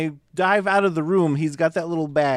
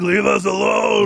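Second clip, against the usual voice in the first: loud, higher, drawn-out mock yell; imitated movie shout; 5 semitones higher, 5 dB louder, slower than usual. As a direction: shout it slowly like a movie character. Acted movie line, shouted.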